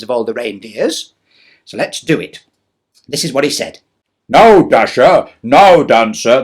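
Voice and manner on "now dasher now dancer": slow, louder, lightly voiced Santa calling; Father Christmas voice. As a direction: call the reindeer like Santa. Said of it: "this is my Father Christmas voice".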